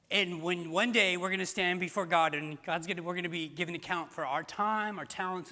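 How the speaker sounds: normal talking voice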